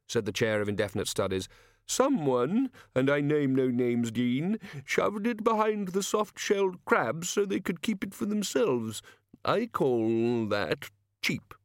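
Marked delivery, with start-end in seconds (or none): 1.9-11.5 put-on higher, slow, aggrieved old don; petty, aggrieved senior wizard grumbling; peevish old wizard. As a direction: sulk about the hidden prawns, drawn out. Chair's complaint about the Dean.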